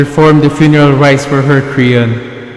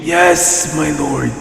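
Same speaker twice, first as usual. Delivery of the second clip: put-on quiet, fearful old servant; hushed, reluctant old herdsman; timid old shepherd; 7 dB quieter, slower than usual.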